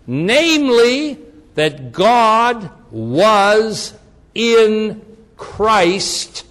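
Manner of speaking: very slow, loud "God was in Christ"; "God WAS IN CHRIST" drawn out and punched word by word; emphatic word-by-word. Real, not acted. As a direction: hammer each word of "that God was in Christ", pausing between them. Preacher stresses key verse.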